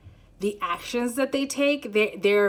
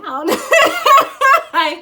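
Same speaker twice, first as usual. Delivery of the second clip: much higher, loud giggle; giddy high laugh; 11 dB louder than usual. Real, not acted. Reader trying to calm down, laughing high. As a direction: squeal of flustered laughter. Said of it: "I need to calm down".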